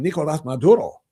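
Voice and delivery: mock Spanish-accented "Nicolas Maduro". Host imitating broadcasters naming the deposed strongman, Spanish accent. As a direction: overdo the Spanish vowels and tapped r on the name. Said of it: "Spanish accented".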